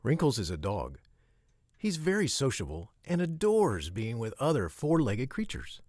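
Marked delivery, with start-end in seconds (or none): none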